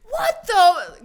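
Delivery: high, loud outburst; imitated at-home tirade; mock mom blowup. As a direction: explode like mom behind closed doors. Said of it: "At home, though".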